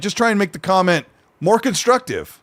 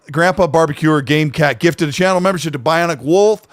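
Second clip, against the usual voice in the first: usual speaking voice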